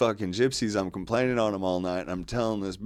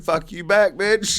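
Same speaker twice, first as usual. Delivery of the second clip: higher and louder, breaking into a laugh; laughing comeback yell; mock yell back; 9 semitones higher, 7 dB louder, slower than usual. Yelling back at callers.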